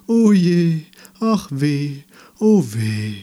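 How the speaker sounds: chorus of moaning, drawn-out wails; woeful tree lament. A narrator's reading. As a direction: wail in woe, high and loud, sinking at the end. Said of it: "klagten sie".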